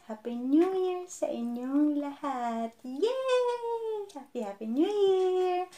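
high, slow, gleeful shout; excited cheer. Real, not acted. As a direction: celebrate with a big cheer. New Year greeting cheer.

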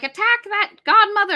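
high, loud battle cry; rally the crowd to charge her; mob shout. Acted. Crowd yelling at the fairy godmother, loud rallying shout.

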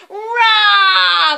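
loud high dragon roar; huge fiery dragon roar. Acted. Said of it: "Let's hear you, everybody".

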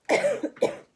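high, loud throaty hack; loud cough. Acted. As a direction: hack as an old woman. Grandma's noise amid bug talk.

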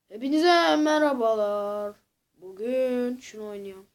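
drawn-out, low-energy greeting; slow deadpan intro. Shown as a demonstration. Vlogger redoing his intro the "sincere" way, slow and deadpan. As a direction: say the hello slowly and without pep. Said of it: "Ben samimi oynuyorum".

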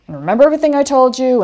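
fast, pointed test question; quizzing. Retold or imitated. Speaker quizzing Marla on the checklist, pointed. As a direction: check she was listening. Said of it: "And I quizzed her".

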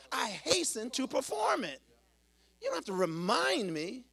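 loud, emphatic sermon delivery; forceful preaching. Acted. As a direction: preach it out as God's own insistent word.

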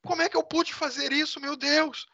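high, pleading cry to God; distressed weeping. Acted. Man crying over what he did, high and distressed. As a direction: sob it out in horror at yourself. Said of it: "e começa a chorar".

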